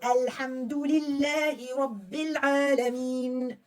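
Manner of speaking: very slow, drawn-out to the end of the ayah; full slow ayah recitation. Shown as a demonstration. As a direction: carry the whole ayah slowly to its end. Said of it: "read the whole ayah".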